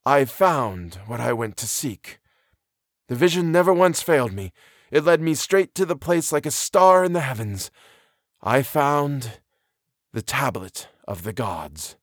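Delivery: grave mystic's solemn declaration. A narrator's reading. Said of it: "replied the other in a solemn voice".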